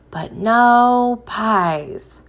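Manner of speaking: very slow, lingering but no pies; mock disappointed refrain; drawn-out refrain. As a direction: stretch the refrain out for the kids. Refrain of children's book.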